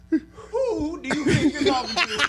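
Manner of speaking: high and slow, laughing through it; a playful put-down, squeezed out through laughter; laughing mock indignation. Real, not acted.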